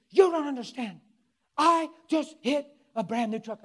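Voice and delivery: loud, high mock yelling; granny having a fit. Preacher replays grandma's outburst at him, mock fit. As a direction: yell it like an overwrought granny. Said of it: "now Granny's having a fit".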